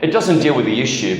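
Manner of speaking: normal talking voice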